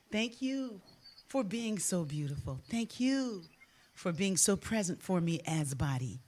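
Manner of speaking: soft, unhurried thanks with pauses; gentle gratitude. Shown as a demonstration. Thanking her own body during a healing practice, gently and softly. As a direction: speak tenderly and softly, as in a self-blessing. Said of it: "ever so gently you say".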